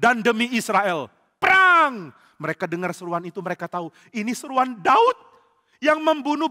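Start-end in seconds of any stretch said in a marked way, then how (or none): 0.0-2.1 slow, loud rallying cry rising to a shout on "perang"; rally the army into battle; David shouts the war cry at the Philistines. Acted. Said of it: "dengan seruan".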